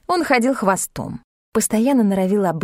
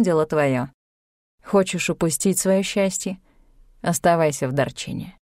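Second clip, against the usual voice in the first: lower, calm, measured elder; Tibetan sage; 3 semitones lower than usual. A narrator's reading. Tibetan guide admonishes Tanya, calm and grave.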